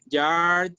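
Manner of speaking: "yard" ending in a ds sound close to Z; voiced DS ending. Shown as a demonstration. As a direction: finish "yard" on a buzzy ds. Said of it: "el sonido de la D S es similar al de la Z".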